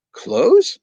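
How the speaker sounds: can't believe what he just asked; startled host; incredulous. Acted. Host stunned by request.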